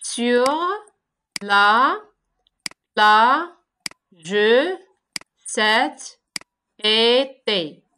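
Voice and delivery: each syllable said apart with long pauses; slow syllable-counting read. Shown as a demonstration. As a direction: tap out every syllable separately. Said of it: "Chaque vers se forme des syllabes".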